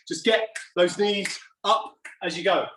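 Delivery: ordinary talk, nothing marked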